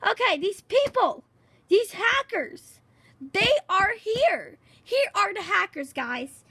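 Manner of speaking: wide-eyed reveal to viewers; excited discovery. Real, not acted. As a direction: announce the hackers with awe. Spots the hackers, thrilled.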